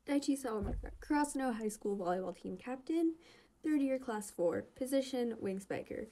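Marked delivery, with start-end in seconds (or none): none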